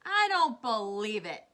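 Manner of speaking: loud, slow disbelieving shout. A narrator's reading. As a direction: raise your voice in disbelief. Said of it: "shouted the centipede, raising his voice".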